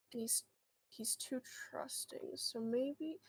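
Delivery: hushed, nearly voiceless; whispered aside. Real, not acted. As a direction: drop to a whisper.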